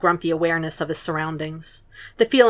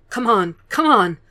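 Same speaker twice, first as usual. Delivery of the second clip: coaxing; 4 semitones higher, 3 dB louder than usual. Acted. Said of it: "the boy coaxed".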